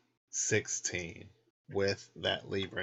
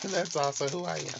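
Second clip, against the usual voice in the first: laughing; 9 semitones higher than usual. Real, not acted. Laughing at herself on camera.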